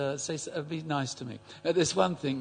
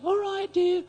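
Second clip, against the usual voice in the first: put-on old lady voice; 6 dB louder, slower than usual. Old lady answers at door.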